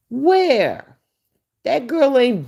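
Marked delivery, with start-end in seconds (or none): 0.1-1.0 higher, louder disbelieving Where?; incredulous where. Real, not acted. Challenging fans who call her beautiful, incredulous. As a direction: demand it in disbelief.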